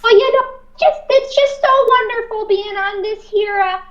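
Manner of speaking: put-on high-pitched Minnesota old lady voice; Minnesota accent. Shown as a demonstration. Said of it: "Can we get a bit of it now".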